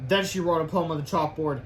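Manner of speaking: ordinary delivery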